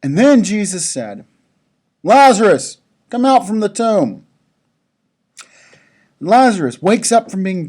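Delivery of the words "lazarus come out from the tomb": commanding call. A narrator's reading. Jesus calls Lazarus from tomb.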